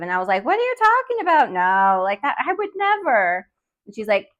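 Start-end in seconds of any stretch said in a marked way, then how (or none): 0.4-3.5 very high, mock-innocent disbelief; feigned indignant denial. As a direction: act out protesting your innocence in a squeal. Acts out denying it to Nana.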